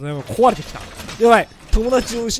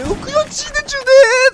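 put-on much higher, louder baby-voice taunt; mocking baby talk; 6 dB louder than usual. Retelling his taunt at the beaten friend, baby-talk mockery. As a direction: coo it like a gloating toddler. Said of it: "とか言ってたじゃん".

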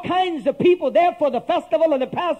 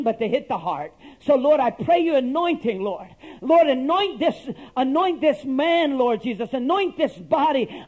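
earnest prayer; 3 semitones lower than usual. Acted. Pastor praying over himself before preaching, earnest and pleading. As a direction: pray it, begging God to open hearts.

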